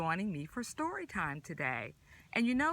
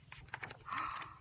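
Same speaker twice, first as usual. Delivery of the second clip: gasp; 10 dB quieter than usual. Acted. Surprise at next food.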